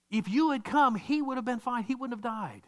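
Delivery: mild accusing plea; Martha's attitude. Retold or imitated. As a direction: push the blame onto him, voice lifted. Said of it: "she's got this attitude".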